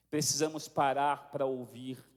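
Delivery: low, slow, measured preaching; solemn exhortation. Real, not acted. Pastor inviting the congregation to the Lord's table, solemn. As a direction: bring the congregation gently down to a reverent close.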